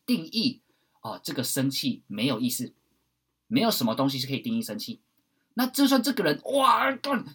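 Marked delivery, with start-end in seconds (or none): 5.5-7.2 sudden higher, louder mock cursing; mock angry outburst. Voicing an angry person to the listener, hammed-up anger. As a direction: play someone blowing up.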